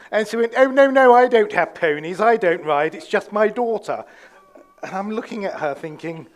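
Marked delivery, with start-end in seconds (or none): 0.6-4.0 loud, affected posh English denial; posh English accent. Retold or imitated. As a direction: mimic Sue copying the posh English lady. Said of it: "she was really posh as well".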